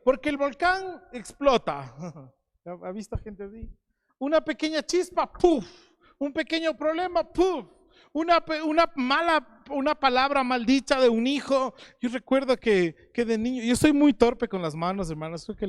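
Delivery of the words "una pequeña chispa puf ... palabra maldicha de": high loud bursts, each puf popping out; explosive puf. Shown as a demonstration. Preacher acting out a temper that explodes. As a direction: burst out like a volcano erupting.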